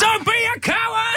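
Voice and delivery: high-pitched comic bellow; mock aggressive-parent yell. Pretend parent at a Rubik's Cube tournament, mock high yell. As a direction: yell the taunt like a touchline parent.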